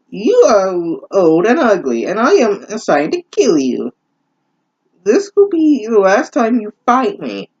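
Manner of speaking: high sneering witch voice; witch threat. Acted. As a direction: taunt her like a cackling hag.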